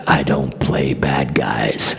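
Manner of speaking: low, slow menacing whisper; Rutger Hauer. Retold or imitated. Intimidating star leans in close to the teen, low slow whisper. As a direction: exhale it slowly, very low, right in someone's face. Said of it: "exhaled in my face and said".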